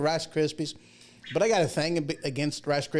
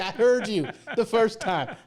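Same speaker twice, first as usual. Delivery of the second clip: snippy lady; 6 semitones higher than usual. Retold or imitated. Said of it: "She's like".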